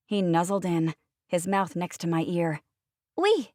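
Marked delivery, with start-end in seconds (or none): 3.2-3.5 high, tiny French "Oui" whispered close; a small boy answering into her ear; little boy voice. Acted. Child Kristoff voiced.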